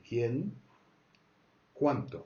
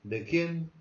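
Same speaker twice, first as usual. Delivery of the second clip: ¿De quién? with quién pitched up high; rising question tune; 6 semitones higher, 4 dB louder than usual. Shown as a demonstration. Teacher demonstrating the question tune, rising on quién. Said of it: "Goes up".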